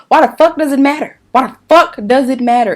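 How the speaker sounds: loud, high, exasperated rant; angry outburst. Real, not acted. Vlogger fuming at mortgage company questions, angry and loud. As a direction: demand an answer, hitting the swear word hard.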